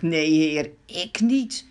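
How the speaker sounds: protesting, rising on the last line; lightly voiced Peter, fervent; eager Peter protesting. A narrator's reading. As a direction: insist you'd never leave him. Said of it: "Petrus had toen gezegd".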